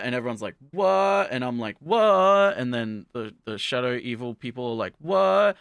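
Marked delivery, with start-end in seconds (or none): 0.7-1.3 high, loud stunned what; mock astonishment; shocked what. Everyone shocked. 1.8-2.6 high, loud stunned what; mock astonishment; shocked what. Speaker's own shock. 5.0-5.6 high, loud stunned what; mock astonishment; shocked what. Villains shocked.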